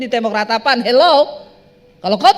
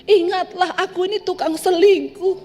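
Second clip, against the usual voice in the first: hammed-up pleading cheater, pitched higher; mock weeping sinner; 6 semitones higher than usual. Preacher mocks a cheating husband crying to God, in a whiny pleading voice. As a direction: whine like an unfaithful husband begging God. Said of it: "engkau nangis".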